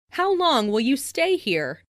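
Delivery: ordinary delivery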